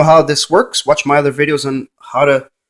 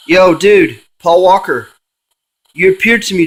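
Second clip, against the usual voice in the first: louder call into the empty room; 2 semitones higher, 5 dB louder than usual. Real, not acted. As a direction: summon him with a raised voice. Calling the ghost by name.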